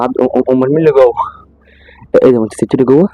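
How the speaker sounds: usual speaking voice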